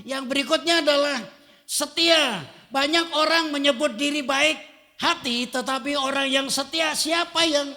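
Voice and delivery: high, loud preaching voice; full-force preaching. Acted. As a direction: preach it with fire.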